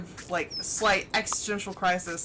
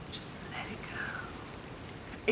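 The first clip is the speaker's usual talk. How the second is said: slow soft whispered "Just let it go"; drawn-out gentle whisper like her dad; dad's soothing phrase; 10 dB quieter, much slower than usual. Retold or imitated. Imitating her father's favorite phrase, slow whisper. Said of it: "in that same tone of voice".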